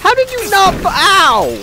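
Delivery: high, loud startled yelp; pained shout. Real, not acted. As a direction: cry out when hit. Hit by enemy.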